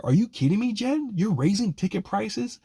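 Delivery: high-pitched incredulous questioning; exasperated, rising questions; indignant disbelief. Real, not acted. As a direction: challenge the owner in outraged disbelief. Indignant challenge to team owner.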